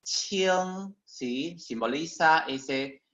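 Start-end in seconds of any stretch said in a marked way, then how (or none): 0.0-0.9 "qing" held long on a high pitch; pronunciation of "qing". Shown as a demonstration. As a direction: model the Chinese syllable "qing", drawn out. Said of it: "se pronuncia".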